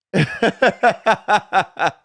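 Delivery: loud high laugh; burst of laughter. Real, not acted. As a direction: crack up out loud. Reacting to stripper photo mixup.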